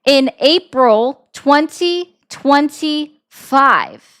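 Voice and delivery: drawn-out, emphatic question with heavy pauses; slow, pointed. Real, not acted. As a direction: spell it out slowly, word by word. Emphatic repeat of ignored question.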